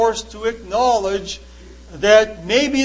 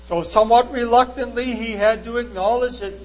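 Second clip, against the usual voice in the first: ordinary talk, nothing marked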